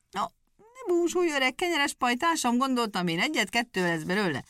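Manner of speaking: the scheming wolf lays out his plan with relish; sly wolf. Acted. Wolf's long speech to the dog. (ravasz farkas, magasabb hangon rábeszélve)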